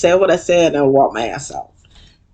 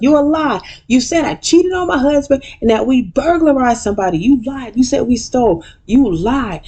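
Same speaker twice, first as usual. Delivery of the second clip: higher mock accusing voice; Toya accusing; 9 semitones higher than usual. Toya calling Quad a liar, in a raised mock voice. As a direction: throw the accusations up high. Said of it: "Toya's like".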